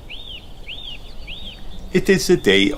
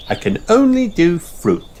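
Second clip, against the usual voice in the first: high little-sheep no; high child's refusal; Susie voice; 12 semitones higher than usual. A narrator's reading. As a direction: answer firmly like a small girl. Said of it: "says Susie".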